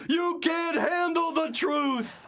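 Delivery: high, slow movie-courtroom shout; dramatic shout. Pretend. Mock courtroom grilling a guest.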